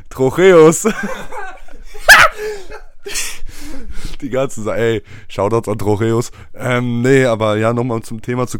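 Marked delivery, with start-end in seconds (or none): 0.9-4.2 laugh. Real, not acted. Laughing at Trocheus.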